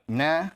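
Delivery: "nah." said short and lifted as a filler sound; Portuguese filler. Shown as a demonstration. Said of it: "I hear a lot of".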